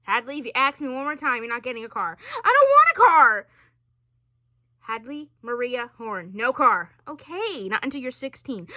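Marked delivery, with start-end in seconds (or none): 2.2-3.5 higher and louder, exasperated; exasperated protest. Real, not acted. Teen protests to parent.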